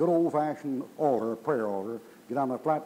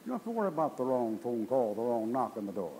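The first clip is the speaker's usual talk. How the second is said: ordinary talk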